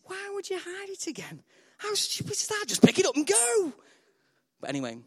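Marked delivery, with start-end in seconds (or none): 0.0-3.7 high mock disbelief; exasperated high squeak; incredulous. Real, not acted. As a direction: scoff at the idea. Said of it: "mocking the parable logic".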